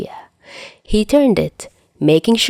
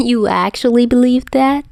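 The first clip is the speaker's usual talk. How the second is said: mocking; 6 semitones higher than usual. A narrator's reading. Dazai mocking Chuya.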